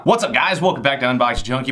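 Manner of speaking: normal talking voice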